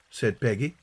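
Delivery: ordinary delivery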